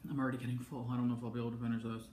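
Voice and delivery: talking while full; quick and soft while stuffed; mouth full. Real, not acted. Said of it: "I'm getting full".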